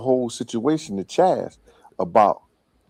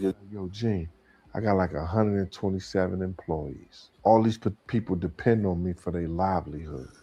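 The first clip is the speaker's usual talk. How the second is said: low, much softer serious talk; hushed earnest plea; 4 semitones lower, 8 dB quieter than usual. Retold or imitated. Puff quietly explaining to Gene why he avoids gunplay.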